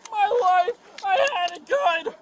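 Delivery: crying out in despair; sobbing. Real, not acted. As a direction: wail through sobs. Suspect sobbing in custody.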